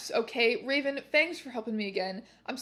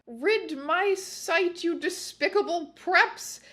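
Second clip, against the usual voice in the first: slow, higher, drawn-out villain voice; villain shout; 6 semitones higher, slower than usual. Acted. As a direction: sneer it down at the preps. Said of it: "he shouted".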